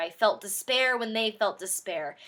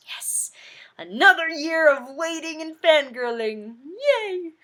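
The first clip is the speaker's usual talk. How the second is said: fangirl cheer; 8 semitones higher, 7 dB louder than usual. Real, not acted. Mock cheer about waiting.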